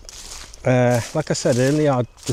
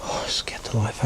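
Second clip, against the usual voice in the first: shaken, hushed whisper; 3 semitones lower, 8 dB quieter than usual. Real, not acted. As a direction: whisper it, still startled. Startled by noise in woods.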